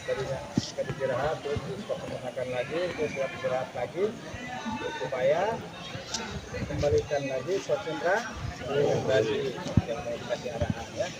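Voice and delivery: low, soft explaining voice; calm briefing. Real, not acted. Instructor calmly briefing cadets after a break. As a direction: talk them down gently.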